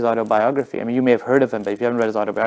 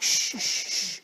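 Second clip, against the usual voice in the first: shushing; 8 semitones higher, 10 dB quieter, slower than usual. Retold or imitated. Audience straining to hear the singer, shushing. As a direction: imitate a crowd hushing one another. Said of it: "And people were like".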